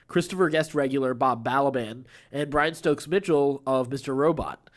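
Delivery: ordinary delivery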